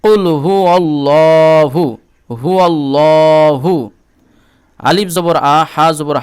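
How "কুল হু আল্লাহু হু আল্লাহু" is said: slow, chanted Qul huwa Allahu; teacher demonstrates the verse; tajweed recitation. Shown as a demonstration. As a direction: stretch the vowels in a chanted recitation. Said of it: "এই জন্য".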